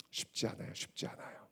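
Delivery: hushed, breathy whisper. Acted. Teacher confiding to students, whispered. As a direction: lean in and whisper it like a secret.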